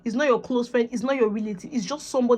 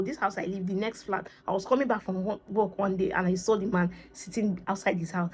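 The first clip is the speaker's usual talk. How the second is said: usual speaking voice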